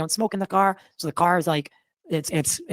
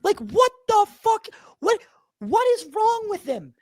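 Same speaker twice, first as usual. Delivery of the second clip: much higher, slow, bewildered what the fuck; baffled, reliving the shock; retelling his reaction after the guest walked out; stunned disbelief; 11 semitones higher, slower than usual. Retold or imitated. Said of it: "And I'm like".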